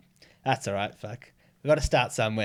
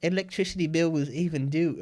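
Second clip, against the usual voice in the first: high, hammed-up put-on accent, mock self-pity; string her along in her own accent; mock Filipino accent; 6 semitones higher than usual. Man stringing along a scam caller, high mock Filipino accent. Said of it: "started talking like that back".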